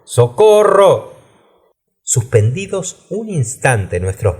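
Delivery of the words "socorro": high, stretched-out cry of Socorro; calling out for rescue, pitched well up; narrator's raised-voice plea for help. A narrator's reading. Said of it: "le entendí gritar diferentes veces".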